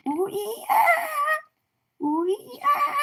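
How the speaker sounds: high hooting monkey call. Pretend. Reading to kids, a high monkey hoot. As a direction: whoop it up like a monkey.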